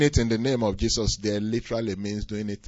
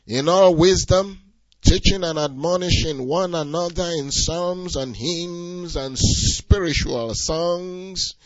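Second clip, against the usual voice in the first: loud, higher, driving sermon voice; full-force preaching; 7 semitones higher, 6 dB louder, slower than usual. Acted. Pastor exhorting the congregation, loud preaching. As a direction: preach it out with fire.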